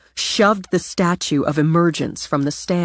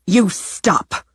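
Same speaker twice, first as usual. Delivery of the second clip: sharp firm You stop, a touch louder; snapped; 5 semitones higher, 4 dB louder than usual. Acted. Cece snapping at her granddaughter to stop, firm and sharp. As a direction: cut her off hard. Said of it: "Snapping it out".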